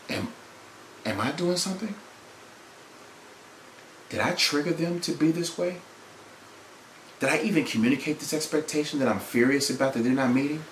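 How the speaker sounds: soft, searching inward questions; quiet self-questioning. Acted. Preacher models seeking inside himself before speaking, quiet self-questioning. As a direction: ask it softly, as if to yourself.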